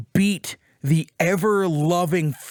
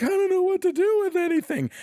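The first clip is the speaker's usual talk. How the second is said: helpless despair; 13 semitones higher than usual. Retold or imitated. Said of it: "she's like".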